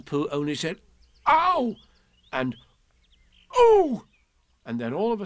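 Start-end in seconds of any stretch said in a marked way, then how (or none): 1.3-1.8 sharp high ow; high yelp of pain. Acted. Pooh being pulled. 3.6-4.1 loud squealing ooh; loud high ooh. Acted. Pooh being pulled.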